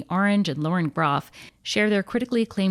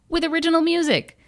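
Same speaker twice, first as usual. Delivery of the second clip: excited; 12 semitones higher, 5 dB louder than usual. Acted. Hyping the show's original music.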